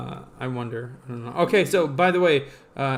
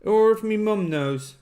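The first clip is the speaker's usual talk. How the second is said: higher-pitched put-on British accent; mock British accent; 5 semitones higher than usual. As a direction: say it like a put-on Brit. Repeats phrase in mock British accent.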